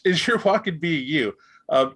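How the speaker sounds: ordinary talk, nothing marked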